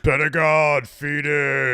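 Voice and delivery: loud, high, rough shout; big gruff promo bellow; gruff wrestler voice. Acted. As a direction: belt out the names, roughed up. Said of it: "Rough it up even more".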